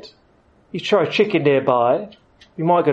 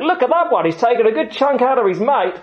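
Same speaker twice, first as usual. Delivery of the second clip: higher, excited croc show announcer; excited showman; 7 semitones higher than usual. Retold or imitated. Croc farm announcer hyping the feeding crowd, excited. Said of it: "blokes on the microphone say".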